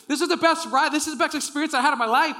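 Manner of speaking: high, loud thrilled exclamation; excited shouting. Retold or imitated. Rider banging on his seat, shouting with excitement. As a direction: gush at full volume, carried away. Retold excitement during the ride frenzy.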